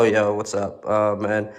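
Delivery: ordinary talk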